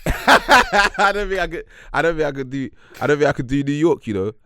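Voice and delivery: high, hesitant stab at New York; attempted New York accent. Put on the spot by co-host, shaky attempt at New York accent. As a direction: try New York through a laugh, repeating yourself. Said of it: "do your best New York accent".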